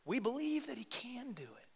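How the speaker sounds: breathy hushed confession; whisper. Real, not acted. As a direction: drop to a whisper for the congregation. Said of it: "whispered line".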